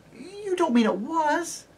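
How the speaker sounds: high, slow, anxious plea; high pleading child; pleading girl. Acted. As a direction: beg him not to mean it. Lucy pleading.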